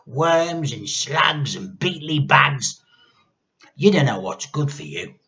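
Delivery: gloating hag. A narrator's reading. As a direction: roll the words like a treat. Grandma voice.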